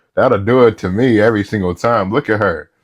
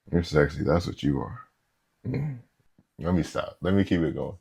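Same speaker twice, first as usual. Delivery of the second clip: much lower and much softer, close to the mic; sultry murmur; 9 semitones lower, 13 dB quieter than usual. Real, not acted. Reactor murmurs a compliment at [name], low and much softer. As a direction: purr it low like a private compliment.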